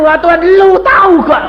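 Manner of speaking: loud, high brash shout to God; hammed-up blunt prayer; imitated impolite prayer. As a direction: mimic a convert with no manners. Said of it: "gak ngerti sopan santun".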